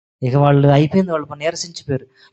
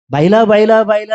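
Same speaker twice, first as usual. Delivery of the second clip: louder retold shout of the name; mock yelling call; 3 semitones higher, 6 dB louder than usual. Preacher mimics prophets shouting for Baal, loud mock yell. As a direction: call out the god's name like the frantic crowd. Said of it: "అని కేకుతుంటే".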